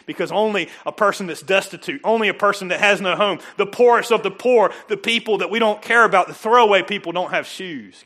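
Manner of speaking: rising to a loud, full preaching push. Real, not acted. Preaching about the destitute.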